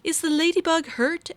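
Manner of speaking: high, worried question; a kind butterfly checking on her; concerned butterfly. Acted. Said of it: "asked Beatrice".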